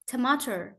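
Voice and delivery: tomato with the hard Indian T lost; American mispronunciation. Shown as a demonstration. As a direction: imitate an American failing at tamatar in tomato. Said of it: "They will still say tomato".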